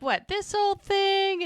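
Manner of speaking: high, drawn-out coy reply; hammed-up fishing for compliments; coy mock modesty.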